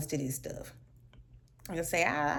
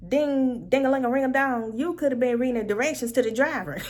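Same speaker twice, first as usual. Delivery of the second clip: louder, mocking singsong; mocking exasperation; 4 semitones higher, 6 dB louder than usual. Real, not acted. To the complaining passenger, loud mocking singsong.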